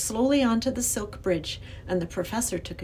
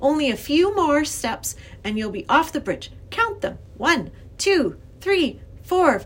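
put-on high, louder child coaxing; encouraging young girl; 7 semitones higher, 4 dB louder than usual. A narrator's reading. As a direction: count the professor through the steps. Girl counts last steps.

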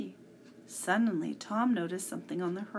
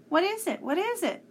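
high, loud frightened repeated question; panicked gasp; 11 semitones higher, 6 dB louder than usual. A narrator's reading. Ben gasping in panic as the wind flattens them, high and loud. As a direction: blurt it out in panic. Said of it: "gasped Ben in panic".

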